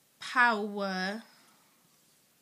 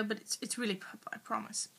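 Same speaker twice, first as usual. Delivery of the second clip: quick aside; 9 dB quieter, faster than usual. Real, not acted. Aside about pen colour.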